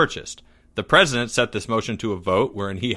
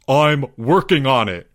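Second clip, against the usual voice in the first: raised, emphatic protest; exasperated cry; 2 semitones higher, 4 dB louder than usual. A narrator's reading. Albert accused over unpaid dues, exasperated cry. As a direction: cry it out in frustration. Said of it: "cried Albert".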